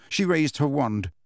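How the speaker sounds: usual speaking voice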